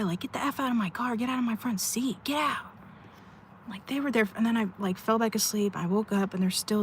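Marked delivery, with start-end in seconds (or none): 0.2-2.7 retold screaming. Imitating a guy screaming at another guy over a car seat, mock yell. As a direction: imitate an angry yell without the volume. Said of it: "another guy screaming at another guy".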